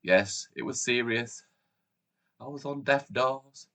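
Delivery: usual speaking voice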